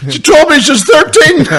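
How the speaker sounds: high, loud protest, drawn out; mock indignant shout. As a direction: shriek the excuse as a punchline. Retold excuse in a punchline, shrieked out.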